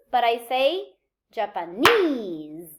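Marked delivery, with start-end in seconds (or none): 1.3-2.7 "Japanese" with a long, punched NESE; stress on the suffix. Shown as a demonstration. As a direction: slowly land hard on the end of "Japanese". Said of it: "So the stress moves to the".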